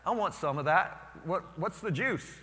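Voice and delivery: high, loud, eager asking; excited question. Retold or imitated. Student at new college asking joyful classmates, excited and loud. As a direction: pester them, amazed and hungry for it. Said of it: "you know the question I was like".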